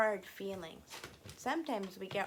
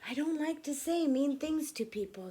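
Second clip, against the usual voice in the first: put-on raised, brighter child voice; Anne's voice; 6 semitones higher, 6 dB louder than usual. Teacher reading Anne's line to children, higher child voice. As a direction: read Anne's line up high. Anne voiced in read story.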